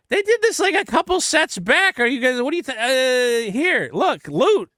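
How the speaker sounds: high-pitched retold protest; exasperated rebuttal. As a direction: argue it incredulously, pitched well up. Retelling own exasperated rebuttal.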